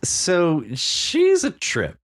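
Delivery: drawn-out amused remark; slow amused drawl. Real, not acted. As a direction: stretch it out, amused. Amused description of character.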